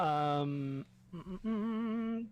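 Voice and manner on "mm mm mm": slow thinking hum. Real, not acted. Stalling, hums slowly.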